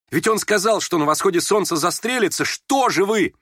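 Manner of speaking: high, urgent alarm; frightened cry. A narrator's reading. Vera grabbing his hands, warning of suicide, frightened. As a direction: plead in panic for someone to act. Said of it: "вскрикнула Вера ... в чрезвычайном испуге".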